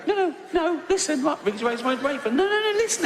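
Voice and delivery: high squeaky protest; flustered high denial. Retold or imitated. Comedian denying to woman, squeaky flustered protest. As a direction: yelp a panicked no.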